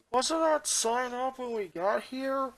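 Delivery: quietly thinking it over, slow; inner thought. A narrator's reading. Boy wondering to himself about the warning sign, soft and slow. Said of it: "he thought to himself".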